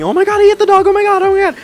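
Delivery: put-on very high, loud teenage-girl shriek; imitated shrieking. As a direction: mimic teenage girls shrieking in panic. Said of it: "kind of shrieking".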